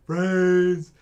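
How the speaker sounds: groaning zombie moaning for brains. Pretend. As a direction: moan it like a shambling zombie. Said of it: "I'm just gonna make zombie sounds at you".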